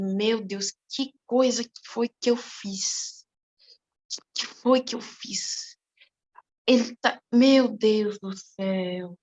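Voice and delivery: soft, slow, low dismay; dismayed remorse. Retold or imitated. Girl realizing she hurt her friend, hushed and slow. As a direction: breathe out the horror of what you did.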